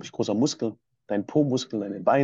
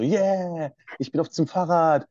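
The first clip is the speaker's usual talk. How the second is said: excited; 6 semitones higher, slower than usual. Retold or imitated. Inner excitement starting the trip.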